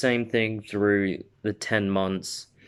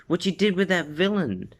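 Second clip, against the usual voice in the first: worried mom voice; 9 semitones higher than usual. Acted. Mom voiced in retold story.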